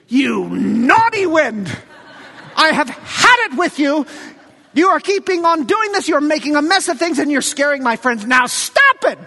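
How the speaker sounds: put-on playful rebuke, like a parent fed up with a toddler; mock scolding. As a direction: scold the wind like a naughty child, half laughing. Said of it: "What does it look like to rebuke".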